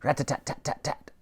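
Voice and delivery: breathy rat-a-tat gunfire; soft voiced machine gun; whispered machine gun rattle. A narrator's reading. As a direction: spit out the gun noise under your breath. Machine gun sound effect.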